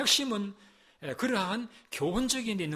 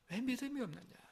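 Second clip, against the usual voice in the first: 믿음이 raised in a soft, much quieter voice; soft, higher rebuke; 5 semitones higher, 9 dB quieter than usual. Acted. Pastor gently asking the congregation why they lack faith, soft and higher. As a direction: drop to a soft, higher, pleading ask. Preacher repeats Jesus' rebuke.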